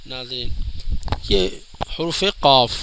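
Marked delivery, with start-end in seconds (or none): 2.4-2.7 قاف with a clear f at the end; final f sounded. Shown as a demonstration. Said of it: "اینڈ کے اندر فا کی آواز نکلے گی".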